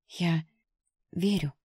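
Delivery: ordinary delivery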